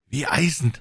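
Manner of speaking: squire's eager high-pitched cry; exclaiming squire. A narrator's reading. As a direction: call it out resolutely. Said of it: "rief der Squire".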